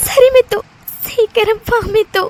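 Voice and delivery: high, tearful pleading; tearful assent. Acted. Crying friend begging Mithu to return soon, tearfully. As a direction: agree through tears, dragging the plea. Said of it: "எதா இருந்தாலும் அழாதடி".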